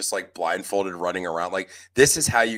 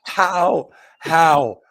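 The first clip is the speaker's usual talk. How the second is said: loud, high exasperation building on each how; exasperated outburst; 6 semitones higher, 8 dB louder, slower than usual. Real, not acted. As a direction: demand it in disbelief. Exasperated at host's writing.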